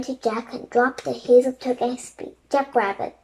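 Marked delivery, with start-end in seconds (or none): none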